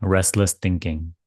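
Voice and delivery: calm spiritual guidance, spacious and gentle; guided meditation. Acted. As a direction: ease them into letting go. Leads guided surrender meditation.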